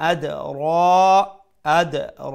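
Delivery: chanted আদ র, rounded long vowel on র; qalqalah, long ra. Shown as a demonstration. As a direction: bounce the d of আদ, then hold a heavy র. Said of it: "আর এক আলিফ লম্বা করমু".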